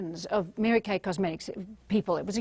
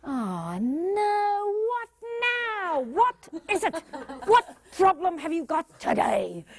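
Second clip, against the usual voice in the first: high, weary mock exasperation; annoyed what now; exasperated; 6 semitones higher, slower than usual. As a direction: groan at the difficult colleague. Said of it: "If you're saying".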